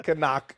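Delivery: "Canuck" for knock, kuh-NOCK; sounded silent K. Retold or imitated. Joking about English spelling, "Canuck" with the K sounded. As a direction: pronounce the K of knock as its own syllable, "Canuck". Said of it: "everyone was like".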